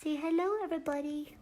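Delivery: high, soft puppy voice; silly dog voice. Pretend. Speaking for the puppy to the viewers, high silly dog voice. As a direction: squeak hello up high for the dog. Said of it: "Why do I talk like an idiot when there's a dog?".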